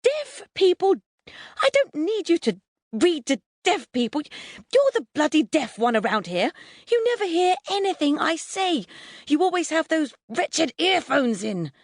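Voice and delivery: high-pitched, furious tirade; explosive rant. A narrator's reading. Mum ranting at her son about listening, exploding. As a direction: rage at him in a high voice. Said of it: "Mum explodes".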